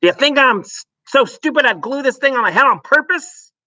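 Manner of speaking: high, loud angry outburst; a furious father shouting at his wife; shouted. Acted. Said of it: "Mister Wormwood shouted".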